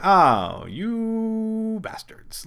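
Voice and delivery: playful outrage at being trolled; slow, stretched half-laughing curse; mock betrayed. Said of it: "I was like".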